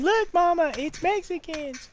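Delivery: put-on high-pitched little kid voice; imitating kid's scream. Kid calling Mama at the clown car, mock squeal. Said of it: "when you hear my kids scream".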